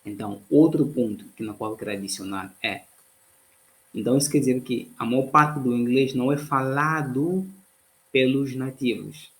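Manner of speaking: ordinary talk, nothing marked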